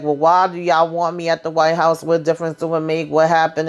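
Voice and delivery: ordinary talk, nothing marked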